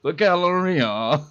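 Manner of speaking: put-on slow, high, loud posh English accent. Vlogger echoes the audiobook's posh English gent, slow high posh accent. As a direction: stretch it out like an unbelievably posh English toff. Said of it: "impersonation of an unbelievably posh dude".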